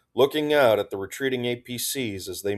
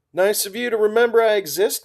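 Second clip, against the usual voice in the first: much higher, playful jab; teasing, bright and high; 12 semitones higher, 6 dB louder, faster than usual. A narrator's reading. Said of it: "Crispy playfully made her presence known".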